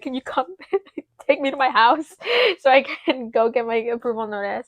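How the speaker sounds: laughing request. Retold or imitated. Retold call to boyfriend, laughing.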